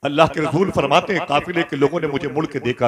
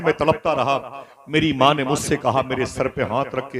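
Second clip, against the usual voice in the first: ordinary delivery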